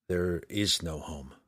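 muttered. Acted. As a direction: mutter it to the wind, slowly. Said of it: "Drizzt muttered".

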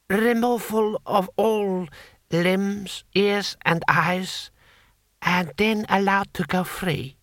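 high, slow, measured courtier; Lord Hong, cold vizier. Acted. Vizier naming the punishment, high measured voice.

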